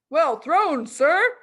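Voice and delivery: loud, high call from afar; shouted. Acted. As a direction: call out across the playground. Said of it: "someone shouted".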